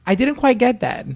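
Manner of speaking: high, prim robotic phone voice; imitating a phone assistant, high and prim; mock Siri voice. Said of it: "I was like".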